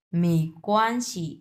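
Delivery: "মেইকোয়ানশি" slow, each syllable given its tone; slow tone demo. Shown as a demonstration. Teacher models "মেইকোয়ানশি" slowly for a beginner, syllable by syllable. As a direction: say "মেইকোয়ানশি" slowly, মেই rising, কোয়ান flat. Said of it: "এটা হচ্ছে দুই number tone".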